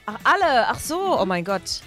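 ordinary talk

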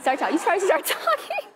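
high squeaky helium voice. Real, not acted. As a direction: talk through the helium. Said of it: "You can start talking now".

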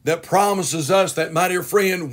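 ordinary delivery

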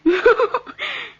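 high, gloating laugh; triumphant laugh. Acted. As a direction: laugh at his shock. Villainess reveals her plot.

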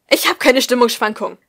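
loud, heated denial; flaring up. A narrator's reading. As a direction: burst out indignantly. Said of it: "brauste sie auf".

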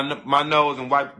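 ordinary delivery

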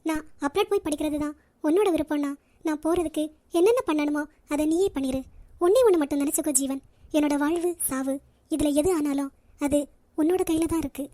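low, subdued, solemn surrender; hurt resignation. Acted. Hurt woman gives in, solemn parting words.

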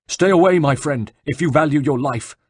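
high, loud, alarmed cry of warning; a panicked monk warns a stranger off; frightened warning. Acted. Terrified monk urging a stranger to keep away.

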